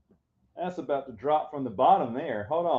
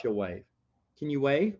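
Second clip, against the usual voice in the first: ordinary talk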